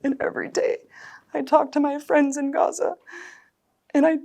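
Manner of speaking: high, breaking voice, close to crying; tearful mother, voice breaking; near tears. Real, not acted. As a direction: speak through tears. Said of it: "I'm so emotional about it".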